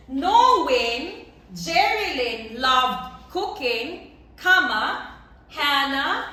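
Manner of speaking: slow, deliberate, each word spaced out for the class; slow dictation. Shown as a demonstration. Teacher to class, dictating the example slowly and clearly. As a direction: spell it out slowly so students can write. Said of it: "teacher dictates the example sentence".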